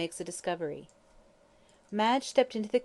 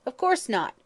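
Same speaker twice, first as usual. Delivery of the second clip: higher and louder, snapping back; sharp retort; 6 semitones higher, 5 dB louder than usual. Acted. Lawyer rebuking the stubborn prisoner, sharp retort. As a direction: cut him off sharply.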